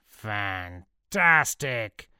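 long drawn-out dramatic cry; screamed exclamation. A narrator's reading. Cesare Borgia reacting to the falling soldiers, drawn-out scream. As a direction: stretch it out in a theatrical scream. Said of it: "Cesare Borgia screamed".

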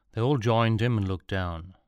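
ordinary delivery, nothing marked

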